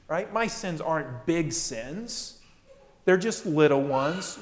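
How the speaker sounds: ordinary talk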